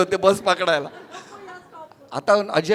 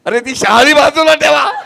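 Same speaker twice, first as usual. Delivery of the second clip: high, loud shouted command; yelling order; 7 semitones higher, 11 dB louder than usual. Retold or imitated. Director yelling at the audience to put down coconuts, loud and high. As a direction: bark the order across the studio. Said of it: "Ajay भालवडकर ओरडतोय".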